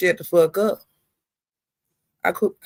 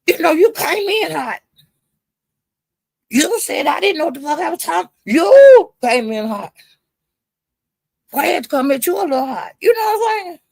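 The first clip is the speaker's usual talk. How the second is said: high-pitched, emphatic retold argument; heated back-and-forth; 6 semitones higher than usual. Retelling the argument over who came in hot, heated. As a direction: push back hard, leaning on the accusing words. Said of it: "Why you hollering at me?".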